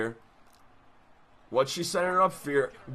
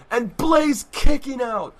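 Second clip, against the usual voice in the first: slow shout, higher and louder; excited slow shout; 8 semitones higher, 8 dB louder, slower than usual. Acted. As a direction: stretch it out, thrilled. Excited kickout call, first take.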